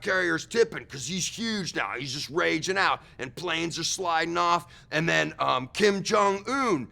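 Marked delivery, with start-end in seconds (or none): none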